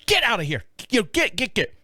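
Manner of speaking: high-pitched mock shooing yell; re-enacted urgent shooing, not full volume; retold shooing. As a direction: act out chasing off a dog. Retells shooing dog while yelling.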